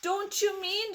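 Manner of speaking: high, slow anxious plea; alarmed shout. A narrator's reading. Grandpa Joe questions Wonka in alarm, high anxious shout. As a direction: dread what the answer is. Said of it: "shouted Grandpa Joe".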